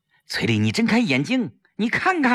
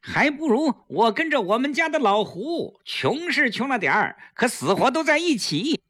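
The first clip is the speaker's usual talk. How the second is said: mock scolding; 9 semitones higher than usual. A narrator's reading. Staged scolding for eavesdropper.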